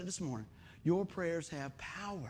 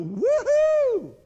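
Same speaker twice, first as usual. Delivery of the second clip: high, loud mock cheer; joyful high whoop; excited whoop; 12 dB louder than usual. Retelling excited reactions to being saved.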